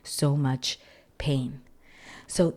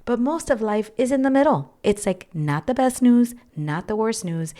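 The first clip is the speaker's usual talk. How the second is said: higher and louder, suddenly animated; emphatic; 6 semitones higher, 6 dB louder than usual. Real, not acted. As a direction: lift up and push it out with energy. Emphatic turn in argument.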